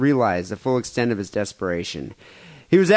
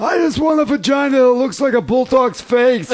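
high, louder silly put-on voice; mock order voice; 10 semitones higher, 4 dB louder than usual. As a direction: squeal it like a ridiculous customer. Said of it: "acted order".

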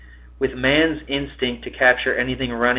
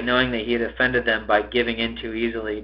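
ordinary delivery

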